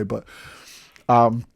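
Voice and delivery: normal talking voice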